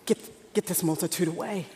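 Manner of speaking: low, subdued aside as the disciples; disciples' hushed plea. Retold or imitated. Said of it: "So the disciples say".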